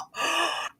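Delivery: soft shocked gasp. Real, not acted. Hears friend lost house.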